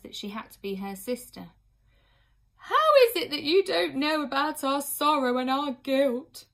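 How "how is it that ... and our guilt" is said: high, loud, keening reproach; a grief-stricken nurse reproaching a girl; grieving nurse. A narrator's reading. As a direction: lament your sorrow and guilt aloud. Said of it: "said the third".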